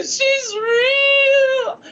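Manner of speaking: very high, long drawn-out squeal; mock tearful wail. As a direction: stretch it into an overwhelmed, sobbing cry. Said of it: "I'm, like, covered in tears".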